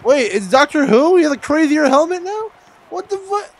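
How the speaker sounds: high, loud disbelief; real astonishment. Real, not acted. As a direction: blurt it out, baffled and high-pitched. Startled reaction to a character's helmet.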